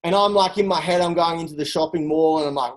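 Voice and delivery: normal talking voice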